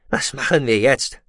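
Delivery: a touch of nerves; nervous question. A narrator's reading. Goloshir facing the approaching disk, nervous. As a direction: ask with mild, anxious urgency. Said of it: "fragte Goloshir nervös".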